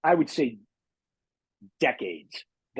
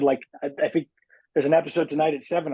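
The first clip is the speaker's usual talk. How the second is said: normal talking voice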